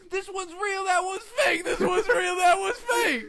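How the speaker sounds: very high, loud jeering yell; taunting shout. Retold or imitated. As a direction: bark it while pointing. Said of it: "he had the belt like".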